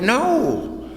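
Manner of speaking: high, drawn-out mock "no"; mock amazed no. A crowd answering the storyteller's question, mock amazed. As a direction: stretch it high, imitating their answer.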